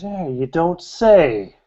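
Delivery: high, slow, drawn-out sarcasm; mock a rival's lucky guess, stretching it out; sarcastic disbelief. Jealous man mocks the flirtation.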